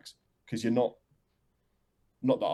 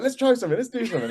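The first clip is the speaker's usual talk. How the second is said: much higher and louder, bursting with keenness; eager bandmate; 12 semitones higher, 5 dB louder, faster than usual. Retold or imitated. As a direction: pitch it up like an impatient friend. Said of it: "He was like".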